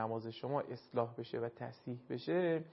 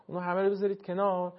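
ordinary talk

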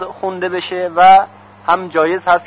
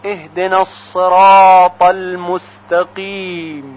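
slow, measured chanted recitation of the verses; Quran recitation; much slower than usual. Shown as a demonstration. Teacher recites Fatiha verses for students, slow chant. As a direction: recite each phrase slowly and reverently.

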